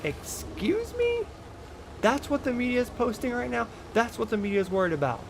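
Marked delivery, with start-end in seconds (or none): 0.0-3.7 mock-shocked squeal of disbelief; incredulous "excuse me". Real, not acted. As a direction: sound like you can't believe your ears. Outraged disbelief at media.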